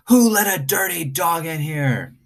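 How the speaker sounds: high-pitched outraged manager; shouting manager. Acted. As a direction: keep up the furious complaint. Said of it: "he kept on shouting".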